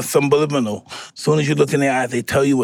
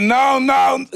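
mock shout; 10 semitones higher, 4 dB louder than usual. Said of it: "She said".

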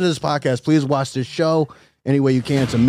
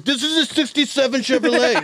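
high mock tough-guy voice; doing the hero's line in a put-on voice; 11 semitones higher than usual. Mocking the show's hero.

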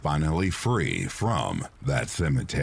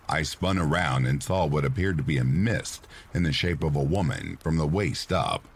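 plain reading voice

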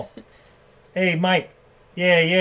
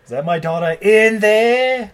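mock anguish; 6 semitones higher, 6 dB louder, slower than usual. Mocking the famous line.

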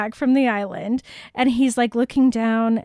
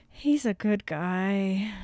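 low, soft, slow aside; mock subdued admission; 4 semitones lower, 6 dB quieter, slower than usual. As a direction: say it slowly and softly, as a reluctant confession. Jacob's grudging praise of Carlisle, acted.

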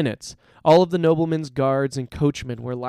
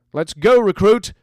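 loud, raised rallying call; 7 semitones higher, 11 dB louder than usual. A narrator's reading. Gang leader beckons the lad to join him, calling out. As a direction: call the recruit over, loud and high. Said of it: "he called to Hap".

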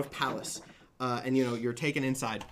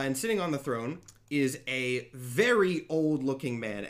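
ordinary delivery

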